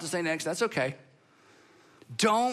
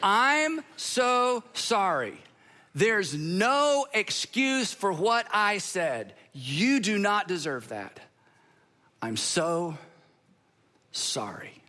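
slow sincere apology; much slower than usual. Shown as a demonstration. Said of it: "rinse and repeat".

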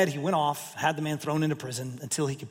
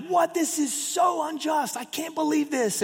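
outraged; 10 semitones higher than usual. Retold or imitated. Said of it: "gritting their teeth and been like".

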